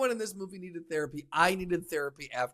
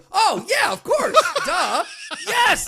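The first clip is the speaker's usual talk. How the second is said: excited realization; 13 semitones higher, 6 dB louder, slower than usual. Real, not acted. Realizing the answer, laughing.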